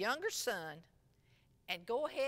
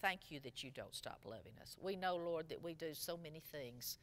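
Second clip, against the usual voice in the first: soft, earnest prayer voice; hushed closing prayer; 4 semitones lower, 4 dB quieter than usual. Acted. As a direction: pray quietly before the congregation. Leads a prayer.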